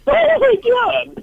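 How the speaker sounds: very high, drawn-out wail; crying. Real, not acted. Caller overcome on the show as host protests, tearful wail. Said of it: "we don't need you crying".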